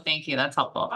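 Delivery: ordinary talk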